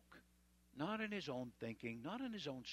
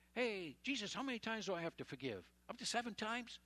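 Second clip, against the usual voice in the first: eager Peter; 8 semitones higher than usual. Retold or imitated. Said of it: "and Peter says".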